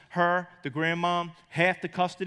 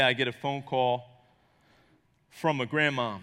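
usual speaking voice

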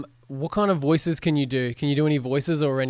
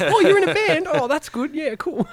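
much higher, laughing boomer parent; cheery parent, pitched way up through laughter; 12 semitones higher, 3 dB louder than usual. Retold or imitated. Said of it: "They're nice boomers".

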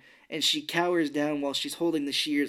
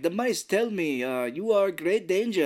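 put-on slow, higher-pitched Russian accent; 5 semitones higher, 3 dB louder than usual. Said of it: "I like your Russian voice".

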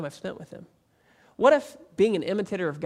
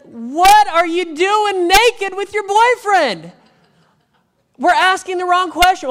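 much higher and louder, slow disbelieving yell; incredulous shout; 13 semitones higher, 12 dB louder, slower than usual. Retold or imitated. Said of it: "And I said".

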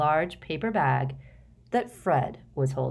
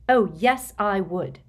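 grim; 3 semitones higher, 5 dB louder than usual. A narrator's reading. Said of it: "said Hermione grimly".

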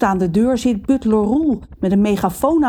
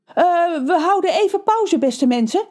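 panicky; 11 semitones higher than usual. A narrator's reading. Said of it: "roept Rons moeder paniekerig".

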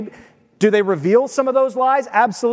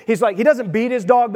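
ordinary delivery

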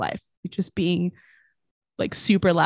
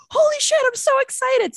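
excited. Retold or imitated. Kid appears on screen, thrilled.

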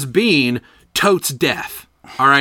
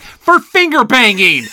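loud, slow, higher-pitched announcement; blurted punchline; 6 semitones higher, 5 dB louder, slower than usual. Retold or imitated. Student revealing the dirty punchline to class, loudly. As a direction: belt out each word for the class.

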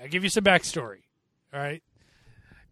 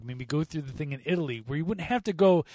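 normal talking voice

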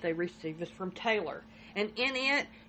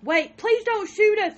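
much higher and louder, slow terrified plea; retold frightened yell; 12 semitones higher, 10 dB louder than usual. Daughter begging her mother on the 911 call, imitated scared yell. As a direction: imitate a girl yelling in fear, stretched out. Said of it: "one girl is heard yelling".

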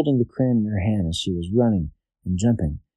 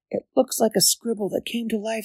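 high, wondering princess voice; a cartoon girl marvelling; 13 semitones higher than usual. Princess character voice.

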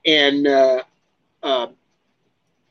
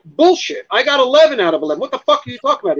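much higher, fast, fed-up objection; indignant retort; 9 semitones higher, faster than usual. Retold or imitated. Correcting Gordon's boast to people, high fast indignant retort. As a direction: snap back that you got them all.

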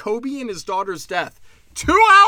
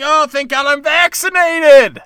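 higher, louder, slow mock gush; mock relieved vaxxer; 7 semitones higher, 10 dB louder, slower than usual. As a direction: ham up a smug vaccine fan. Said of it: "I'm saying".

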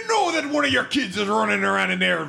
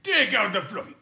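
ordinary talk, nothing marked

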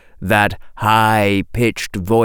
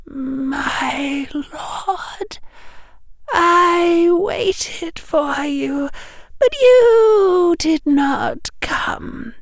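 high, slow, wavering devoted plea; broken worshipful plea, drawn out; low mutter; much slower than usual. A narrator's reading. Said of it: "All her words came out in a low mutter".

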